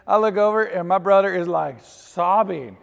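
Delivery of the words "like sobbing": ordinary delivery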